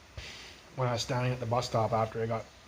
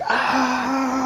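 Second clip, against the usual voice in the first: high, loud imitated yell; imitated scream; 12 semitones higher, 7 dB louder than usual. Man retelling a stranger yelling in the street, imitated high scream. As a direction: belt out a stranger's wild scream. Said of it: "he was screaming at his shoe".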